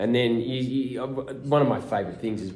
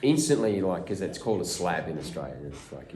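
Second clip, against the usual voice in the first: ordinary talk, nothing marked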